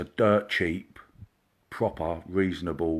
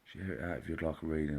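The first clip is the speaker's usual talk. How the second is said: quiet; 4 semitones lower, 11 dB quieter, faster than usual. Real, not acted. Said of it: "I'm going a bit quiet".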